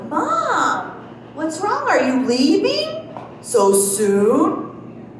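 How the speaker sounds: high-pitched mock concern, drawn out on the last words; feigned dismay. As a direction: act sorry she's going, much too sweetly. Feigned dismay at mom leaving.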